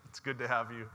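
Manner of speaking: ordinary talk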